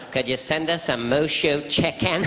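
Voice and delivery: slow, exaggerated presidential voice; deliberate mock presidential delivery; George Bush impression. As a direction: drawl out a takeout order like a caricatured president. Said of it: "My George Bush impression was".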